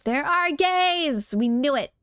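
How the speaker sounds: high, drawn-out triumphant cry; gleeful whoop of confirmation; excited cheer. Real, not acted. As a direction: burst out loud, vindicated. Cheering a confirmed guess mid-letter.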